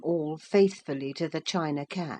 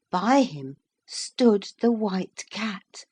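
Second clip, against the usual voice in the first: ordinary delivery, nothing marked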